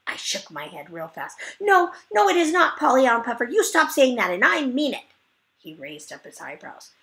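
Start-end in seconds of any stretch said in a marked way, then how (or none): 1.6-5.0 put-on loud, upset kid yelling no; high shouting protest. Junie B yells at Polly to stop saying she drools, high and loud. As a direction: shout it high, stamping your foot.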